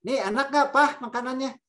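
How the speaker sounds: high, bright-voiced wife asking; wife asking husband about her cooking, hopeful; wife asking eagerly. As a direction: ask your husband if he likes the food.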